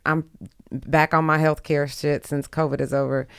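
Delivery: ordinary talk, nothing marked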